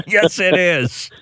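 high, drawn-out words through real laughter; helpless laugh pushing the pitch up. Real, not acted. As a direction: crack up while saying it. Host cracks up at quoted remark.

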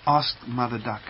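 ordinary delivery, nothing marked

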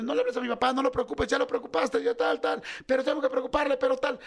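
voces agudas atropelladas; discusión familiar imitada. Retold or imitated. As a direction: imita a cada uno opinando alterado. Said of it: "cada uno con su decisión". (pleito familiar en tono agudo)

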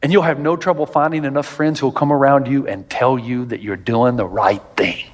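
ordinary delivery

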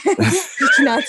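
laughing out the words; words half laughed. Real, not acted. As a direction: laugh through it. Laughs mid-sentence.

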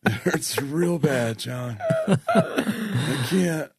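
very slow, fading pained groan; gravely ill Tony Romo. Pretend. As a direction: drag it out, out of breath. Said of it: "why is that your Tony Romo voice".

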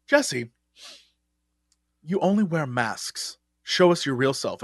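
retold solemn challenge with long pauses; lightly voiced earnest drama coach; intense acting teacher. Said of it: "that was intense for a teacher".

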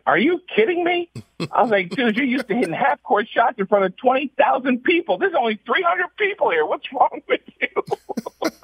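hammed-up exasperated teammate; disbelief. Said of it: "I'm like".

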